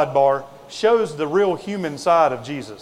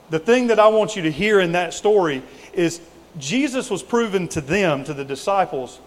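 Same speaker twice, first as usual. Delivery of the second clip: ordinary delivery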